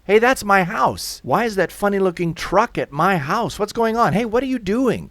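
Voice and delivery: high, loud, indignant protest; alarmed shouting. Acted. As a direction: call out in outraged alarm. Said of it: "They're shouting".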